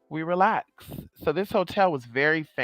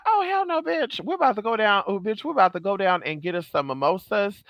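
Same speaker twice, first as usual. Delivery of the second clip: much higher, excited exclamation that settles down; shocked outburst; 5 semitones higher than usual. Retold or imitated. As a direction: react to "haunted" with alarm, then decide to leave. Clerk says hotel is haunted.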